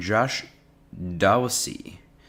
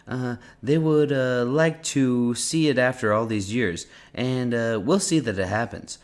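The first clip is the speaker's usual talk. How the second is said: put-on Trump accent; 4 semitones higher than usual. Reading Trump's answer on Afghanistan, in his accent. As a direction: imitate the president's rambling delivery. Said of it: "in a Trump accent?".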